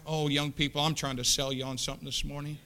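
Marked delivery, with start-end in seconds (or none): none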